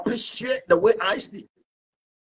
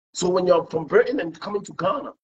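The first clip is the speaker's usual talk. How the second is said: ordinary delivery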